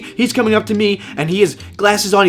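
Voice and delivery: ordinary talk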